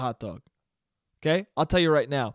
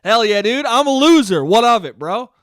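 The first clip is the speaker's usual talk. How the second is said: loud, higher-pitched defiant bro voice; proud loser dude; 5 semitones higher, 8 dB louder than usual. Acted. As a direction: boast like an unashamed loser.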